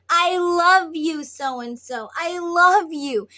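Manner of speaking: high, loud, drawn-out love cry; loud declaration. Acted. Building up your twin by name, loud loving declaration. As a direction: proclaim love at full voice.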